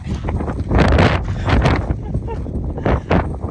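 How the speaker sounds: breathy joyful laughter; happy laugh. Real, not acted. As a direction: laugh out of relief. Laughing after arriving.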